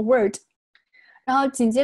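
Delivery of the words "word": "word" with no l before the d; "word" cut to wurd, l dropped; missing l demo. Shown as a demonstration. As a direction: say "word" as the mistake for world. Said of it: "不要读成word".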